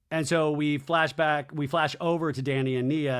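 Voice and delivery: normal talking voice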